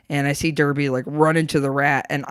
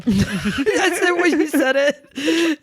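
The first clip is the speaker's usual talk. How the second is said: laughing; 10 semitones higher, much slower than usual. Real, not acted. Laughing at host's phrasing.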